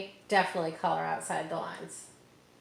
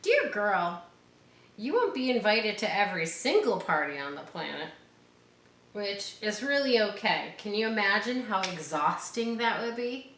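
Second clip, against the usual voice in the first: put-on reading to children; 3 semitones higher, 4 dB louder than usual. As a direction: read it like a storybook to a child. Reads picture book aloud.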